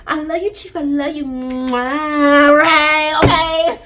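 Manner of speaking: high, kissy love declaration; mock lovesick. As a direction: blow the kiss loud and playful. Character blows a kiss.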